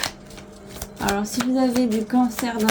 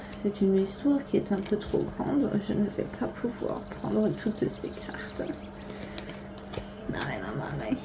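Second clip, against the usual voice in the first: put-on German accent; 8 dB quieter than usual. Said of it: "je prends un accent allemand".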